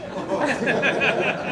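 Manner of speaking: high burst of real laughter. Real, not acted. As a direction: crack up at the partner's joke. Laughs at partner's joke.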